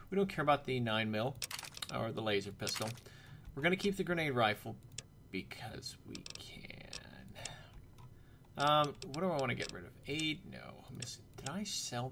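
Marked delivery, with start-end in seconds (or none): 5.3-6.7 slow, hushed, stretched-out ending; mock-dramatic whisper. Gamer justifying keeping the grenade rifle, slow whisper. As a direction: whisper it slowly for effect.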